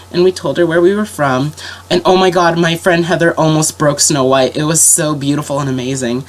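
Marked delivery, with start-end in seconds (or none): none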